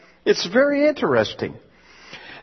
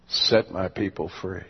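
voice of God; 11 semitones lower, 7 dB quieter than usual. Acted. God commands John.

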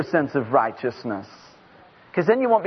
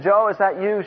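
ordinary talk

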